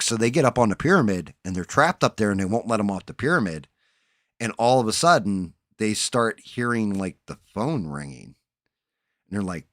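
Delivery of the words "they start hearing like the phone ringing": ordinary talk, nothing marked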